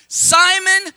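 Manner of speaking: loud, high call of the name. Shown as a demonstration. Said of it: "Say that one more time".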